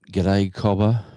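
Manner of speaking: Australian "G'day, cobber" with "cobber" rising at the end; friendly Australian hello to a mate; casual Australian greeting; Aussie greeting. Shown as a demonstration. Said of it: "that's the next one".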